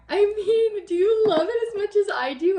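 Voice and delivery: high, bubbly delighted question; gushing. Real, not acted. Showing viewers her topiary mantle topper, gushing. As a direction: beam and gush at the viewers.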